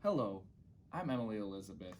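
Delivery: plain reading voice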